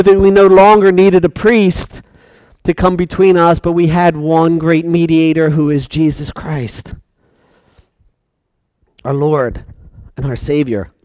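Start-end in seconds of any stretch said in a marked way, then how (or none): 5.5-10.9 soft, low and reverent; hushed reverence. Acted. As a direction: drop the voice low for the Savior. Hushed close of the point.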